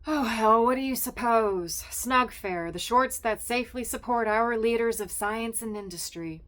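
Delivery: loud, bitter and sarcastic; cross. Acted. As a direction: spit it out fed up with the job. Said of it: "don't sound so cross".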